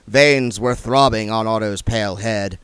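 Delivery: ordinary delivery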